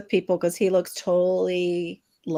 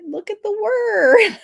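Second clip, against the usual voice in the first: mock exasperated retort; 12 semitones higher, 3 dB louder, slower than usual. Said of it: "It's like".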